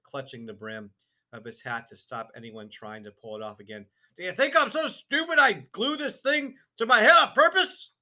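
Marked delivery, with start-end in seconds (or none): 4.2-7.9 loud, high furious shout; angry shout. A narrator's reading. Father shouting at wife and daughter, loud and furious. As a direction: roar it in outrage. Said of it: "Mister Wormwood shouted".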